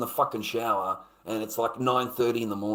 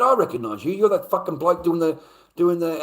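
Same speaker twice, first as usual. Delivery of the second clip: retold challenge, higher and louder; confrontational; 7 semitones higher, 7 dB louder than usual. Man challenging the stranger at his table, confrontational. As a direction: go straight at him, loud and accusing.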